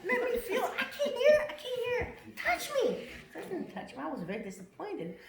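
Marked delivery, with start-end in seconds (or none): 0.1-3.1 high, loud, begging to be touched; pleading with the spirit face, excited and high; excited plea. Retold or imitated. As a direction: call out eagerly to the face.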